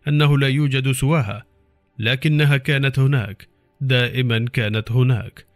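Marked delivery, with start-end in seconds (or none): none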